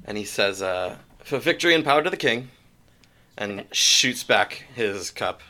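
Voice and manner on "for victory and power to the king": fast rallying toast; quick ceremonial cheer; rapid toast. Acted. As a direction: rattle off the toast like a ritual. GM character toasts the king.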